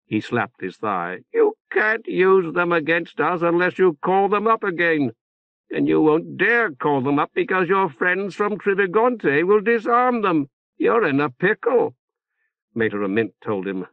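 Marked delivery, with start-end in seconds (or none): 1.3-12.0 higher, giddy mocking voice; high gloating jeer of a pleased councillor; gleeful shrill taunt. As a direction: crow over them, barely holding back the laughter. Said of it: "Potto's giggle mounted to a shrill laugh".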